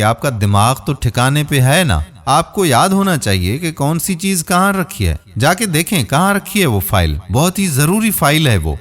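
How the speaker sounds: ordinary delivery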